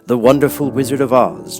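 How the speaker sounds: plain reading voice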